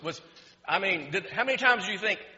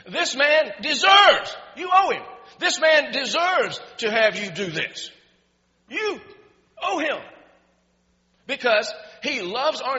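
loud, high-pitched begging on the elders' behalf; earnest pleading; 5 semitones higher, 8 dB louder than usual. Retold or imitated. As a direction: plead forcefully, leaning hard on key words. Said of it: "They pleaded earnestly with him".

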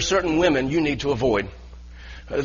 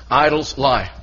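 usual speaking voice

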